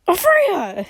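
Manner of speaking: high, delighted exclamation; bursting with joy at recognizing her; getting excited. Acted. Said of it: "getting a little excited".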